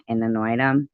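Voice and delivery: ordinary delivery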